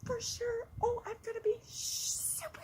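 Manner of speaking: very high, breathless eager promise; eager Mortimer. Acted. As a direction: squeal it like an excited little boy. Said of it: "And Mortimer went".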